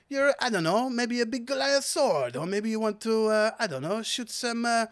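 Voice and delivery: lilting, hammy French sales patter; put-on French accent. Seller suggesting upgrades to customer, French accent. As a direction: talk up the wares in French-accented English. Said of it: "I will do this accent".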